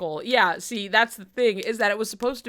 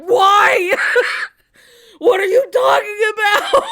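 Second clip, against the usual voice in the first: much louder, higher, laughing shout; laughing yell; 10 semitones higher, 10 dB louder than usual. Retold or imitated. Yelling at Canary in disbelief, laughing.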